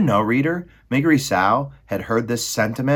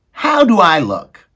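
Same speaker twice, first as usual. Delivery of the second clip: put-on high, loud servant-girl voice; Meg's voice; 10 semitones higher, 12 dB louder than usual. Maid Meg answering Roscuro, high and loud. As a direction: squeak it out as the simple maid.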